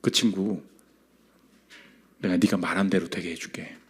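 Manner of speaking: low, hushed voice of God with long pauses; quiet divine voice, sinking to near-whisper. Retold or imitated. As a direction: speak as God, very softly and gravely. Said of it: "하나님이 갑자기 마음을 확 주시더라는".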